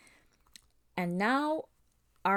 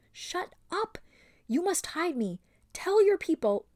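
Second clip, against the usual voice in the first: higher, desperate urging; urgent pleading girl; 10 semitones higher, 4 dB louder than usual. A narrator's reading. Aravis begging her friend, high urgent plea. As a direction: press her to hide you. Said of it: "whispered furiously in her ear".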